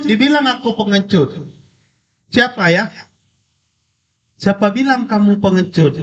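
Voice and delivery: put-on high-pitched child's complaint to a parent; upset child. A narrator's reading. Angry child reporting the mocking voice to father, upset child. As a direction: tell on someone in a high, worked-up child voice. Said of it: "Anak ini marah".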